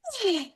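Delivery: high, falling sigh; audible releasing sigh, sliding down; demonstrated sigh. Shown as a demonstration. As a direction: sigh it all out. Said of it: "I need to sigh things out".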